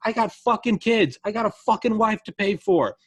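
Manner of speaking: high, loud desperate shout; retold yell. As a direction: yell it like a father losing his shop. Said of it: "he's yelling at the protesters".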